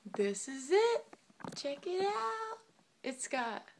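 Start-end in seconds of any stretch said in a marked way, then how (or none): 0.1-2.6 showing off. Real, not acted. Showing off the hoodie.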